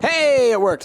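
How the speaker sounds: high, loud, thrilled cheer; real delight. Real, not acted. Player finding that the trick worked, excited. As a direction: burst out in pleased excitement.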